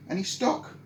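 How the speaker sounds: squeaks up high on any stock; hopeful question. Acted.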